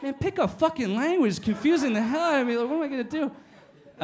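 high, loud, laughing exasperation; fed up with the boss's Denglisch; exasperated. Retold or imitated. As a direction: throw your hands up verbally. Exasperated at language mix.

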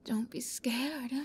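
ordinary delivery

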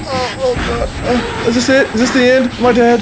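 high, breathless and shaken. Real, not acted. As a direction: gasp it out, still terrified. After being caught, dazed.

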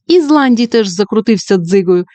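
plain reading voice

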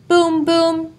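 high, hollow booming sound word; echoing boom. A narrator's reading. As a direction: make it ring like an echo. Echoing stomps on log.

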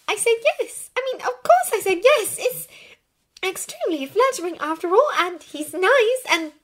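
put-on high-pitched, flustered girl's voice; flustered Hermione. A narrator's reading. Hermione admitting she said yes, flustered. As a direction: gush and justify in a high voice.

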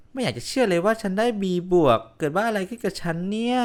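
can't believe the grade; disbelief. Acted.

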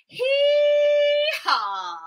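high loud hee-haw; shouted donkey hee-haw; donkey bray. Shown as a demonstration. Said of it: "make a donkey noise".

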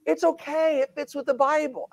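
hammed-up soothing voice, like talking down to a worried crowd; mocking old-earth reassurer. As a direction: parody of a pastor calming people about an old earth. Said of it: "a whole bunch of other people going".

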